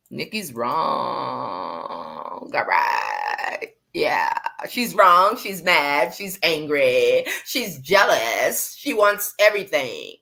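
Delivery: drawn-out, exaggerated mock accusations; mocking agreement. Host parroting what critics say about Nikki, mocking. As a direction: stretch out each charge in mockery.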